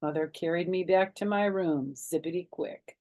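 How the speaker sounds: ordinary delivery, nothing marked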